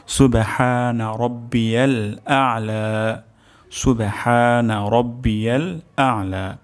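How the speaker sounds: slow, careful recitation of সুবহানা রব্বিয়াল আ'লা. Shown as a demonstration. Teacher reciting the tasbih twice for students. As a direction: recite the tasbih slowly, syllable by syllable. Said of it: "আরও দুইবার উচ্চারণ করছি".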